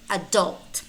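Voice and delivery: adult as "a-DULT"; weak first syllable, strong DULT in adult; stress on second syllable. Shown as a demonstration. Teacher offers two choices, second-syllable stress in adult. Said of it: "You can pick one".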